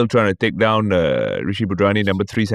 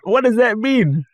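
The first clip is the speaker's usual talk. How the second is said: high, slow baffled question; 13 semitones higher than usual. Retold or imitated. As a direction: ask in disbelief. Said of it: "I'm like".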